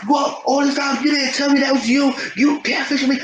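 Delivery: a hammed-up high furious yell; angry accusation. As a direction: yell it at her, outraged. Angry fiance confronts catfish.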